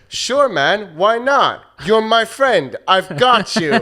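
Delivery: high-pitched, hammed-up dubbed voice; bad redub. Podcaster performing a redubbed meme for a co-host, badly dubbed. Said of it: "it's been redubbed really bad".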